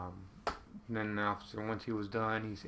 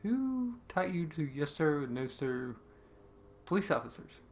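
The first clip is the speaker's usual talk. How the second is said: retold bafflement; 5 semitones higher than usual. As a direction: imitate a baffled officer, stretching the opening. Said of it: "he looked at me like".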